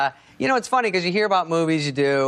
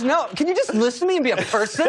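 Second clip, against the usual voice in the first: exasperated plea; 8 semitones higher than usual. Retold or imitated. Acting out woman pleading for attention.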